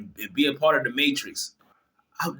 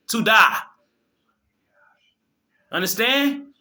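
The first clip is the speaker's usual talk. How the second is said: fiery preaching; 8 semitones higher, 4 dB louder than usual. Real, not acted. Fiery sermon about fearlessness to viewers.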